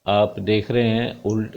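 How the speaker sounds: ordinary talk, nothing marked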